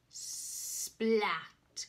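plain reading voice

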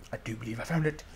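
low, soft, cautious realization; hushed discovery. Real, not acted. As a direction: say it low, half to yourself. First hushed realization of find.

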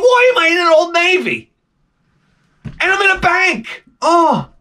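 very high, hammed-up outburst; exasperated wail. Dreamer baffled by bank inside Old Navy, exasperated wail. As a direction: wail it in comic frustration.

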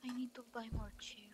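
hushed fast whisper. Pretend. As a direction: breathe it out as a secret. Whispering a game plan.

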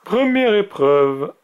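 high, slow tournament announcement; drawn-out announcer's call; announcing. A narrator's reading. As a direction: proclaim it slowly to the crowd. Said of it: "annonça le grand mage".

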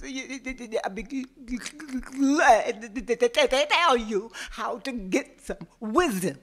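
stuttering, scared; stammering fear. Acted. Frightened man stammers to the tiger, afraid. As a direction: get the words out through terror. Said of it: "he was so afraid".

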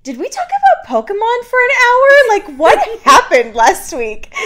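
high, fast, incredulous; baffled disbelief. Retold or imitated. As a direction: act stunned at your own podcast. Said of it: "I was like".

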